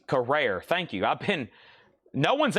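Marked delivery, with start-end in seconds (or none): 0.0-0.6 Carrere said kuh-RARE, second syllable stressed; corrected pronunciation. Shown as a demonstration. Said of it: "It's pronounced Carrere".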